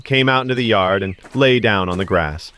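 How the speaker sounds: ordinary delivery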